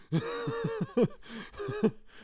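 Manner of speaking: a very high, squeaky laugh. Real, not acted. Laughing about food he can't refrigerate, high squeaky laugh. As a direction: giggle at the absurdity.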